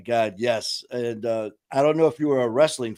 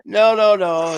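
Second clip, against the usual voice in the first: loud refusal; 7 semitones higher, 6 dB louder, slower than usual. Retold or imitated. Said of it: "I go".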